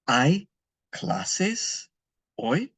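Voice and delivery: "Hay clases hoy?" rising high on clases; slow rising question on "Hay clases hoy?"; question tune. Shown as a demonstration. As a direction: ask it, pitch climbing up. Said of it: "change the intonation in your voice".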